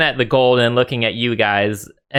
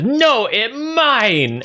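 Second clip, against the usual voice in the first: very high, slow ogre wail; second greedy ogre; 13 semitones higher, much slower than usual. Acted. Second ogre fighting for the gold, very high greedy ogre. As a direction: outdo the rival ogre with a higher, drawn-out claim. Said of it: "And then this ogre says".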